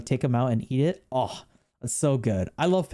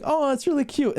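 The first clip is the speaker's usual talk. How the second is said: mock cute gushing; 11 semitones higher than usual. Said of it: "you see me being like".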